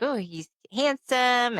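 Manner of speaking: slow, lightly dreamy swoon over a crush; retold admiring swoon. Retold swooning over new boyfriend.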